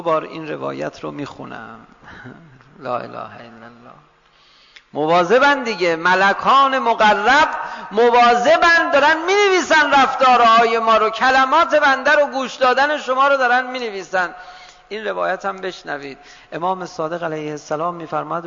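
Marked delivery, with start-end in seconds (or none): 4.9-14.3 high, loud, urgent warning from the pulpit; forceful preaching. Real, not acted. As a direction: drive the warning home at full voice. Warns congregation angels are recording. (هشدار پرشور و بلند منبری)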